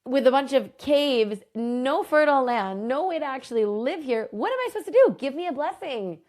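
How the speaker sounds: rising to a high cry of protest; complaining Achsa. Retold or imitated. Achsa protests to her father about the waterless land, high aggrieved plea. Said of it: "So she's saying".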